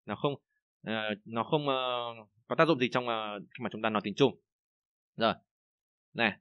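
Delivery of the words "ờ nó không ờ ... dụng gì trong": ordinary talk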